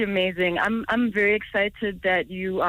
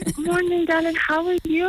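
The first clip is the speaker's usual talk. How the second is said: excited; 9 semitones higher, 3 dB louder than usual. Real, not acted. Excited greeting on radio call.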